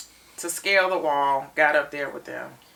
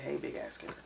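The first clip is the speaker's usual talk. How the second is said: weak whisper; 12 dB quieter, faster than usual. Retold or imitated. Judith answering softly after coma.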